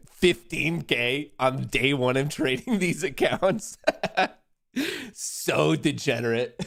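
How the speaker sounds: giggling between boastful lines; laughing through it. Real, not acted. Streamer laughing at Trex after winning trade, giggling. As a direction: keep breaking into laughter.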